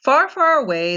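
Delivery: plain reading voice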